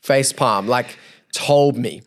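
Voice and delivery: ordinary talk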